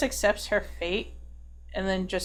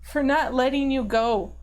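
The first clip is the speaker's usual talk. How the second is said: raised, pained voice confessing guilt; tearful apology; 4 semitones higher, 3 dB louder than usual. Acted. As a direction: apologise on the verge of sobbing. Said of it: "And she's just sobbing now".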